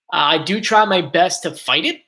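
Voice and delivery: normal talking voice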